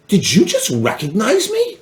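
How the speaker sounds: high-pitched, incredulous question; retold disbelief. Survivor player to a stranger in a hotel lobby, disbelief. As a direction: ask it high, as if astonished. Acting out his surprised reply.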